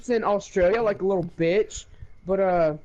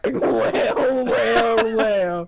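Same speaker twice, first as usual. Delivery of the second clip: slow, louder smug gloat; gloating villain; 3 semitones higher, 4 dB louder, much slower than usual. Retold or imitated. Cole gloating over his opponent's weak roll, smug villain gloat. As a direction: relish the moment like a cartoon villain. Gloating after rolling ten.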